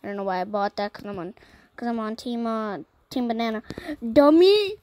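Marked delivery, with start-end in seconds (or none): 4.0-4.7 high, loud shout of "Dummy!"; self-scolding shout. Real, not acted. Kid yells at himself after a misclick in the game. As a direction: yell at yourself for a dumb mistake.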